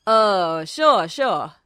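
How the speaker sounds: loud low eager voice; Hecht eager. Acted.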